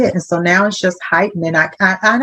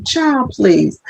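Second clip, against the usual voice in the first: higher-pitched teasing brush-off; sassy mock disbelief; dismissive "child, please"; 6 semitones higher than usual. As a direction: wave away their excuse playfully. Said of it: "when I say it like".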